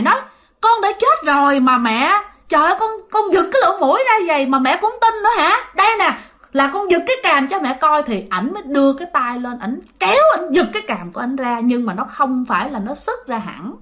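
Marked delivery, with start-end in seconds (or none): 0.6-8.0 retold exasperated son, climbing in pitch; exasperated insistence. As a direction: act a son losing patience with his mother. Son exasperated by disbelieving mother.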